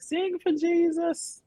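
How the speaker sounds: high mock grandma chant; imitating grandma. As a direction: coax like a churchy grandmother. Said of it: "my grandma was like".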